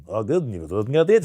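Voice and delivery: gibberish imitation of Russian; mock Russian. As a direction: fake speaking Russian with swooping intonation. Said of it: "Speaking Russian".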